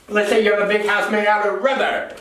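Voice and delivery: ordinary talk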